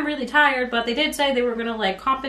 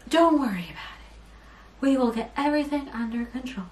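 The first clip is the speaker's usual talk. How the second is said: softer, soothing official voice; mock calm; 5 semitones higher, 3 dB quieter than usual. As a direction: imitate his calming customer-service tone. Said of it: "like, so calm".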